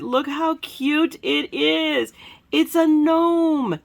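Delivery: high, slow, doting coo. Real, not acted. Crafter showing viewers a cute gnome card, cooing.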